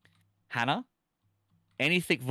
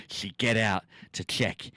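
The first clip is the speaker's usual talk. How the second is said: louder, hammed-up campfire reading; milk every broken sentence for laughs; dramatic story reading; 6 dB louder than usual. Streamer reads a badly typed horror story to chat, hammed up.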